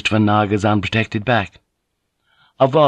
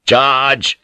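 short, raised battle order; shouted command; 5 semitones higher, 4 dB louder than usual. Acted. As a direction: call the charge to the troops. Commander orders a bayonet charge.

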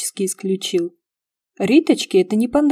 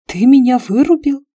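louder, higher indignant question; 6 semitones higher, 7 dB louder than usual. A narrator's reading. Heroine confronts the man who knocked her out, indignant. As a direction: demand an answer, outraged. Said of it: "возмутилась я".

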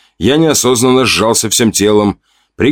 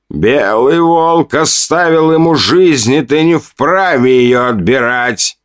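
higher, slow, bold declaration; loud and confident; 6 semitones higher, slower than usual. A narrator's reading. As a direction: stand up and proclaim it. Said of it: "вдруг громко, уверенно произнёс Сови".

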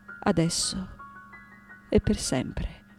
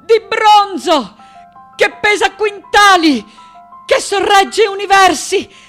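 high, full-voiced shout, sustained at the top; impassioned cry; 12 semitones higher, 9 dB louder than usual. Daughter crying out to her absent mother, impassioned shout. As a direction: call out desperately with full force.

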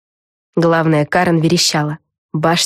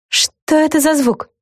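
slow, higher-pitched character voice; puzzled question; 11 semitones higher, slower than usual. A narrator's reading. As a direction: ask it slowly and high. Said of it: "спросила Флафанора". (удивлённый высокий вопрос)

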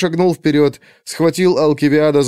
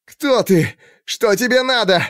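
frightened squeal; 8 semitones higher than usual. A narrator's reading. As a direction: squeal it like a terrified captive. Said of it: "взвизгнул Алкивиад". (испуганный высокий взвизг)

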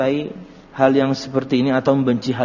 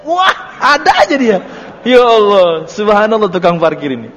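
mock exasperation; 4 semitones higher, 5 dB louder than usual. Exasperated at parking attendant.

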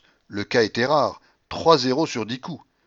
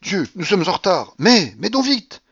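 high, loud, impatient prodding; flustered, pressing exclamation; urgent urging; 7 semitones higher, 6 dB louder than usual. A narrator's reading. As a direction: hurry them before the bet closes. Gambler urging a late bet.

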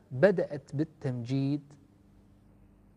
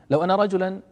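normal talking voice

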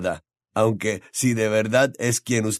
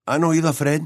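eager question about Fred; light excitement, asking his friends; excited; 5 semitones higher, faster than usual. Acted.

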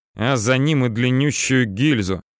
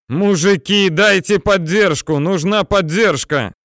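loud, raised plea for backup; urgent calling out; 7 semitones higher, 4 dB louder than usual. A narrator's reading. Soldier begging tankers for fire support, urgent loud call. Imagined plea to the tank crew.

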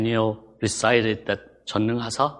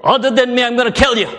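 loud, raised royal threat; king's raised, forceful warning; threatening king; 10 semitones higher, 9 dB louder than usual. Acted. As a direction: issue a loud decree as a menacing king. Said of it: "king says".

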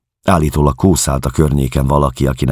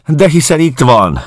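much higher, loud call out of a window; shouted call; 14 semitones higher, 7 dB louder than usual. Acted. Landlady at the window spotting the lost guest, high loud call. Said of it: "kiáltotta valaki a panzió ablakából".

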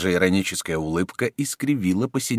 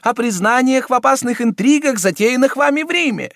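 high, loud prelate pressing for confession; a much higher and louder voice, insistent; urging cardinal; 7 dB louder than usual. A narrator's reading.

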